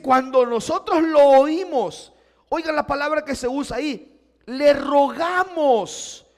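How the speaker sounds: loud, high pulpit reading; preaching at full force. Acted. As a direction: stress the scripture word to the crowd. Preaching the text loudly.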